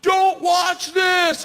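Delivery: high, loud, drawn-out shout; re-enacted parting yell from a plane door; yelled warning. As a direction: holler it slowly over the roaring wind. Yelled warning before jumping out.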